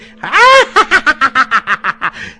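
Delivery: high, loud shrieking cackle; hysterical laughter. A narrator's reading. Spinster aunt in her second crisis, hysterical laughing. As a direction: burst into a long falsetto laughing fit. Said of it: "risa isteriche, rotte qua e là dagli strilli".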